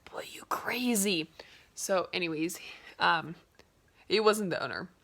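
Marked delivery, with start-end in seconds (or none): 0.1-1.3 slow mocking whisper; whispered aside. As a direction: whisper it behind his back. Imagined thought of preacher.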